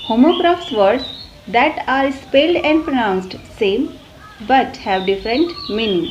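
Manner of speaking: reading a definition out slowly for notes; very slow, deliberate classroom definition; slow dictation. Acted. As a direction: spell it out word by word like dictation to a class. Teacher dictates English definition.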